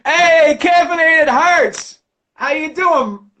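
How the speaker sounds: loud, high, slow greeting; cheery call-out. Real, not acted. Streamer greets a viewer, loud and bright.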